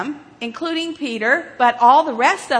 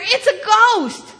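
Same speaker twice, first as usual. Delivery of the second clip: high, mildly frightened cry; fearful cry; 8 semitones higher, 4 dB louder, slower than usual. Acted. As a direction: cry it out higher, drawn out, in alarm. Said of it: "they cried out in fear".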